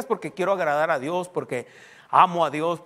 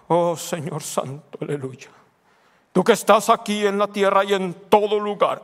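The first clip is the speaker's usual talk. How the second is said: mock religious intonation; 3 semitones higher, 6 dB louder than usual. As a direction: parody a churchy prayer, swelling louder. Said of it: "si esa persona así habla".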